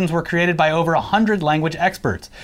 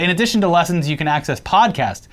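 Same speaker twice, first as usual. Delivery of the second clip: usual speaking voice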